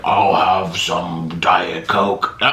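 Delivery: slow, low drawn-out man's voice; deep-voiced young passenger. Retelling a young passenger's drink order, deep slow voice. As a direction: imitate a surprisingly deep voice, very slow. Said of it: "he would have, like, a high-pitched voice".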